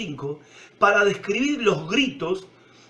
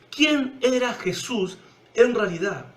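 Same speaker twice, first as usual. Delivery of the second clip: ordinary delivery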